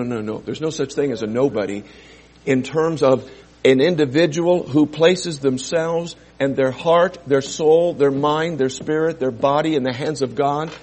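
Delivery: ordinary talk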